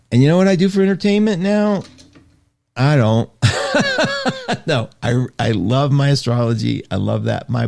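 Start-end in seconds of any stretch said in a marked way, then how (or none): 3.4-4.6 high laugh. Real, not acted. Laughs at own joke.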